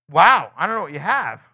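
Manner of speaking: a loud, baffled mock doctor; puzzled doctor. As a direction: mimic a stumped doctor. Doctor voiced in story.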